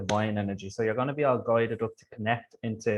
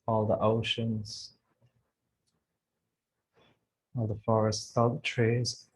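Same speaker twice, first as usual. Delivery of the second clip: guided meditation. Acted. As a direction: gently guide them through visualization.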